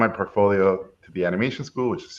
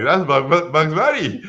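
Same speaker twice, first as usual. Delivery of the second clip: flustered protest; 5 semitones higher, 5 dB louder than usual. Retold or imitated. Retold flustered teen defending drawing.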